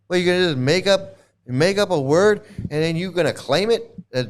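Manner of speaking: ordinary talk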